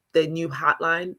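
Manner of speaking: ordinary delivery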